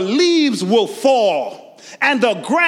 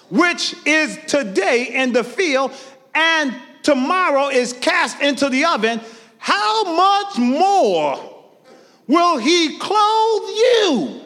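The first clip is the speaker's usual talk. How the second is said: high, slow, ringing scripture reading; preached scripture; 8 semitones higher, slower than usual. Acted. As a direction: draw out the verse and lift it.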